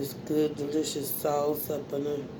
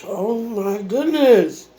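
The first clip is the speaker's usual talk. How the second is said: delighted; 4 semitones higher, 10 dB louder, much slower than usual. Real, not acted. Savoring a delicious bite.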